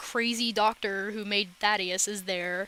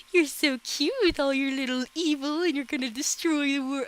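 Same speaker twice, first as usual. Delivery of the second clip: put-on high, louder gushing over an evil baby; mock baby talk; 5 semitones higher, 4 dB louder than usual. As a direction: coo as if to an adorable little monster.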